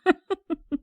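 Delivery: high, scornful chuckle; mocking laugh. Gang boss scoffs at the kid's warning, mocking laugh.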